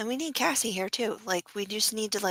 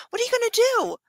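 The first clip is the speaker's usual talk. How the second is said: high-pitched mock; 5 dB louder, much faster than usual. Real, not acted. High mocking shrug.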